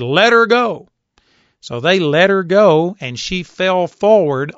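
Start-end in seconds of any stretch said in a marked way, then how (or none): 0.0-0.9 loud commanding order; a preacher shouting an order; raised voice. Retold or imitated. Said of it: "he raised his voice and said".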